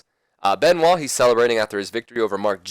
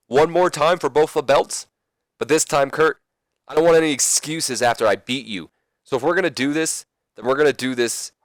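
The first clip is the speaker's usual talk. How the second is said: higher-pitched, punchy challenge promo; acted champion laying down his terms; Chris Benoit; 7 semitones higher, 4 dB louder than usual. Champion accepts the rematch offer, high animated promo voice. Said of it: "finally respond saying".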